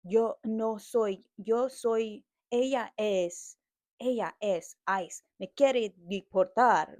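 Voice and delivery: loud, halting imitation of terrible Spanish; mocking bad Spanish. Rider mocks passenger's terrible Spanish, loud broken-Spanish imitation. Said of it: "his Spanish is so fucking terrible".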